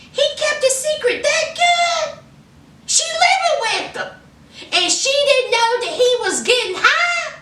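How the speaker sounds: can't believe she didn't know; shocked disbelief. Real, not acted. Shocked at the hidden secret.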